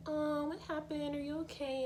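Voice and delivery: gentle consoling adult. Retold or imitated. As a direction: soothe a crying little girl. Actress consoling crying child.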